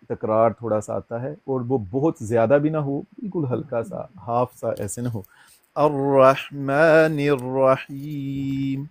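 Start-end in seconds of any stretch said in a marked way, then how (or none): 5.8-8.8 الرحمن الرحیم with a light, slight roll on را. Shown as a demonstration. Teacher shows the light takrir on ra. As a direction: roll the r only a little, slowly. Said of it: "را میں نا تھوڑی سی تکریر".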